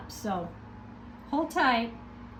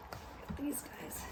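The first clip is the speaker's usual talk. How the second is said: breathy whisper to the camera; 16 dB quieter than usual. Real, not acted. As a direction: whisper it secretly.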